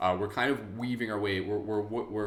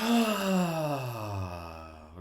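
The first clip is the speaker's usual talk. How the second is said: long audible sigh out; releasing sigh; demo sigh; 3 dB louder than usual. Shown as a demonstration. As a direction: let the breath go with a sigh. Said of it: "Sigh out any sort of sounds".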